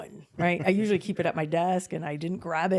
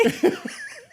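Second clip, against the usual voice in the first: high squealing laugh; 4 dB louder than usual. Real, not acted. Laughing at friend's concern.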